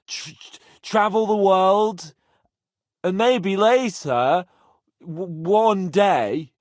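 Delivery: put-on slow, loud, slurring drunk; drunk stammer. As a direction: stumble over words as if drunk. Drunk rambling about dreams.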